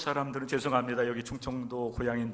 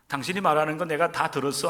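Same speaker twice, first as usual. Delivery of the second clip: ordinary talk, nothing marked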